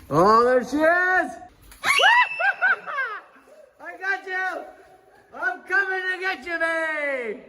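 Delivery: high, sing-song chasing voice; playful monster voice. Pretend. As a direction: creep up on the child playfully. Playing hide-and-seek with child.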